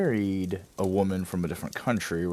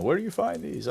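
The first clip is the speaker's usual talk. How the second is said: impressed buddies; 10 semitones higher than usual. Retold or imitated. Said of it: "send them to my buddies and be like".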